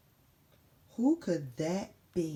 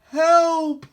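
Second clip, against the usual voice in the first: shout; 9 semitones higher, 14 dB louder than usual. Acted. Calling for help.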